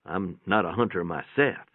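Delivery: ordinary delivery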